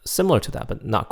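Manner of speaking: normal talking voice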